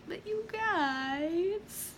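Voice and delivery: very drawn-out, high, soft whine; mock pleading. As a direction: stretch it into a playful plea. Playful plea to viewers, repeated.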